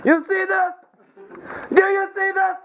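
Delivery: high-pitched mock outrage; pretend outrage. Gang boss confronts his crew, mock outraged.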